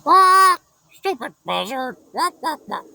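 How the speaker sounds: high squawking parrot voice; chirpy squawking parrot; cheerful parrot. A narrator's reading. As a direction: cheerfully insult her like a parrot. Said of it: "cheerfully said".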